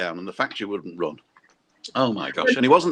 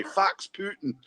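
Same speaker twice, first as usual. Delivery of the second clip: higher mock broad Scottish "Fax Putin"; broad Scottish accent; 7 semitones higher, 5 dB quieter, slower than usual. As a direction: imitate the Bavarian doing broad Scots. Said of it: "how to go broad".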